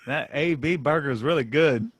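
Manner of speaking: mock a Southerner praising the burger; Southern drawl. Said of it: "they were saying".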